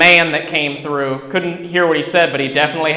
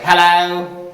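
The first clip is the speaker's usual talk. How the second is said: louder, imitated British hello; put-on British accent, called out; British woman's accent; 3 semitones higher, 5 dB louder than usual. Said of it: "she had a British accent".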